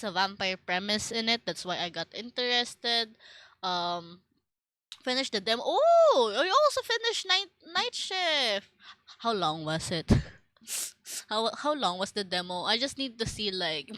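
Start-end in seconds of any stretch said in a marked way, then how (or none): 5.6-8.7 surprised. Real, not acted. Sudden realization.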